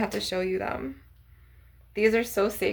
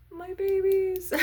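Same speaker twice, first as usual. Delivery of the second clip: high, slow cooing over lost treasures; doting coo; 11 semitones higher, 3 dB louder, slower than usual. Retold or imitated. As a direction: coo it like greeting long-lost children. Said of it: "you're like".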